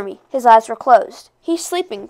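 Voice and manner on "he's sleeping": soft, higher-pitched hush; whispered. A narrator's reading. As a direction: say it softly so as not to wake him. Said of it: "Janina whispered".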